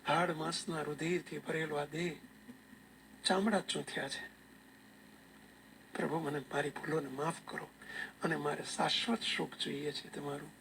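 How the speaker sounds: hushed, understated penitent confession; tearful plea. Retold or imitated. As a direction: plead softly for forgiveness, as if through tears. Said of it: "ચોધાર આંસુએ રડતા રડતા".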